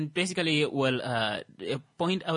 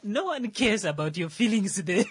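high and loud, fired up; excited; 10 semitones higher, 3 dB louder than usual. Real, not acted. Continues the gamer rant.

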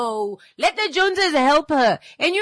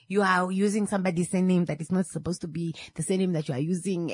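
ordinary talk, nothing marked

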